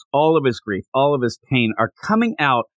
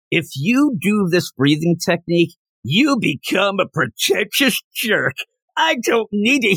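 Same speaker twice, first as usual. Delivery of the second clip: high-pitched mock tirade; mock yelling; 6 semitones higher than usual. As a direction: rant indignantly, without real shouting. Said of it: "He just starts yelling".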